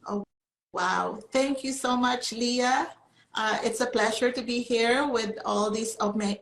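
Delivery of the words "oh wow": normal talking voice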